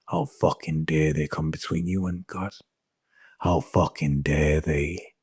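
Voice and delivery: ordinary delivery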